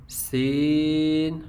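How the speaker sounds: سین held slowly, light with a whistling s; thin seen. Shown as a demonstration. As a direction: stretch سین thin and hissing. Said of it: "باریک پڑھیں گے".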